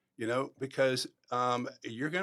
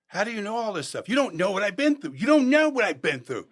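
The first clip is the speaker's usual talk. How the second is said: high-pitched protest, building louder; exasperated outburst; 7 semitones higher, 6 dB louder than usual. Retold or imitated. Player challenges his advice, exasperated outburst. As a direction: push back hard, voice climbing.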